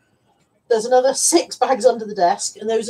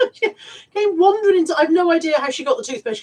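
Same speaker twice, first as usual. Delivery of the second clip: high, laughing storytelling; 7 semitones higher, 5 dB louder than usual. Real, not acted. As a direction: giggle through the tale. Laughing retelling of toothpaste theft.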